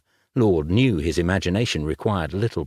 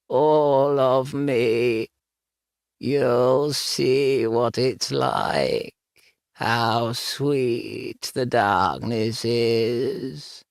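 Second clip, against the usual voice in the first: high, slow croaking voice; croaking ghost voice; 3 semitones higher, much slower than usual. Acted. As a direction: croak it out like a dead woman. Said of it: "the voice croaked".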